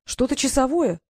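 puzzled and intrigued; surprised question. A narrator's reading. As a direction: wonder aloud, lifting the question. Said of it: "удивлённо спросила Диана".

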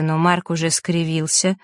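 ordinary delivery, nothing marked

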